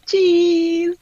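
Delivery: high, loud photo-pose cheese; drawn-out "cheese". Real, not acted. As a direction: sing out a long, high cheese for the camera. Posing for a screenshot.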